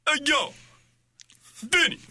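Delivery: calling out. Real, not acted. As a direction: shout for someone's attention. Calling out a name.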